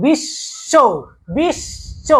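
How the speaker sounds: বিশ্ব said as "bish-sho" with a long hiss; doubled sh. Shown as a demonstration. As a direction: hold the sh in বিশ্ব, then release "sho". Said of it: "দুইবার উচ্চারণ".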